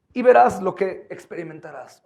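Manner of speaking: high, loud fiery preaching; preaching at full force. Acted. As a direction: pound the call to repent.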